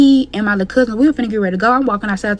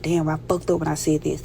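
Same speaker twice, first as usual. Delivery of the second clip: muttered regret; 4 semitones lower, 7 dB quieter than usual. Retold or imitated. Thinking aloud after her slip.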